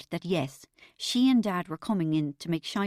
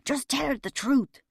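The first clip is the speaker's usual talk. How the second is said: higher, urgent hiss; tense stage-whispered retort; 6 semitones higher, 3 dB louder than usual. A narrator's reading. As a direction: hiss it back at your wife. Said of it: "Dad hissed back".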